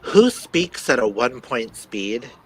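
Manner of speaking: slowly drawn-out, incredulous question; mock-slow. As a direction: drag it out to mock the slow speed. Mocking slow audiobook playback speed.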